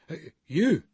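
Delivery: the man sneers on recognising Bruce; sneering. A narrator's reading. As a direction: sneer the word at him. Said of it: "he sneers".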